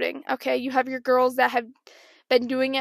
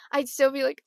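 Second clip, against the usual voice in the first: ordinary talk, nothing marked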